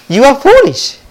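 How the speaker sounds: high, loud scoffing jeer at the man; mocking laugh in voice. A narrator's reading. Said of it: "he started to laugh".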